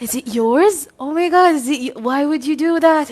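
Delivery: imitating a shocked, upset mom. Daughter imitating her shocked mom. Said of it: "And my mom was like".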